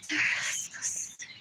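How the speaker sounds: breathy whisper of disgust. Acted. Whispering to the camera about them. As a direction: hiss it under the breath. Repeated phrase, whispered take.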